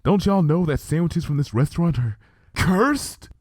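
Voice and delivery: a touch of nervous quaver, leaning in; half-whispered worry about a curse, lightly played. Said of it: "in a low and quavering voice".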